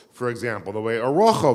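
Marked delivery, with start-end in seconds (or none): 1.1-1.5 Rochel said RAW-khul, stressed at the start; Yiddish pronunciation. Shown as a demonstration. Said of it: "the way it is in Yiddish".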